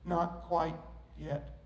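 soft, low, patient reply; hushed, gentle refusal; smiling potter. Acted. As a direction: say it quietly with a calm smile. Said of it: "the potter smiled and said".

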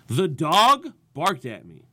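"dog" swung up and punched loud; stress on DOG. Shown as a demonstration. Comedian showing the next set's inflection, stress on "dog". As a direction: hit "dog" hard, let the rest drop away. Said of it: "Pick a different word in your jokes to put inflection on".

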